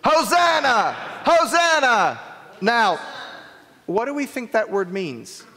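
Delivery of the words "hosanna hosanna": high, loud acclaiming cry; crowd calling out Hosanna. Acted. Teacher acting the palm-waving crowd for children, loud cry. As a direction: call it out like a cheering crowd. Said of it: "What were they saying?".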